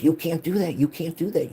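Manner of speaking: high, loud mock alarm; quoting the cousin's panicked scolding, high and loud; retold alarmed warning.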